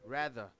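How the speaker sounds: RATHER said low, with an English R at the start; English R pronunciation. Shown as a demonstration. Teacher reminding a student about the R in RATHER, carefully pronounced. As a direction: model the R of RATHER as English, not as H. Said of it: "Lembre-se da pronúncia do, do, do R".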